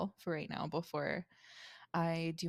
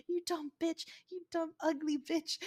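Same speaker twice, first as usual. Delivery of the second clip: much higher-pitched mimicked abuse; mock angry insult; 10 semitones higher than usual. Clerk imitating a guest refused a free room, mock angry. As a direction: imitate a guest suddenly turning nasty, pitched way up. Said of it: "they switch from being really upset".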